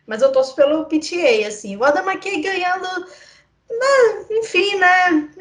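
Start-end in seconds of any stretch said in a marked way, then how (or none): 1.8-5.3 high whiny fake sob; mock crying. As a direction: whimper it in mock despair. Dreading a McKay Oscar win.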